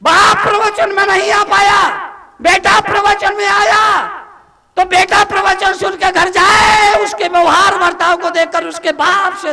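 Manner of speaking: high-pitched, shouted sermon delivery; preaching at full force. Real, not acted. Monk restating the father and son example, full-force preaching. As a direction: cry it out to the congregation, easing slightly at the end.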